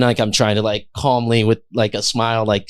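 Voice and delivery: ordinary delivery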